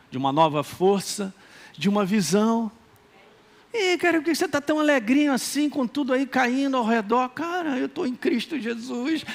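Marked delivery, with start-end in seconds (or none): 3.7-7.3 higher, teasing doubter asking why so cheerful; skeptic's voice. Retold or imitated. A friend taunting the cheerful believer in a teasing voice. 7.3-9.2 softer, slower, serene answer; calm reply. Retold or imitated. Believer calmly answering the skeptic, softly and slowly.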